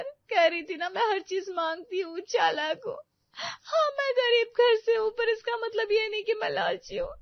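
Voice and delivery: tearful, hurt pleading; crying. Acted. Preeti to mother-in-law, crying and accusing her. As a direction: talk through sobs, wounded. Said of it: "क्यों रो रही हो?".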